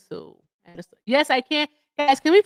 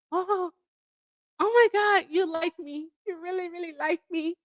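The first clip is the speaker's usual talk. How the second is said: Sally Field acceptance speech; 7 semitones higher, 4 dB quieter than usual. Retold or imitated. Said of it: "I'm having my Sally Field moment".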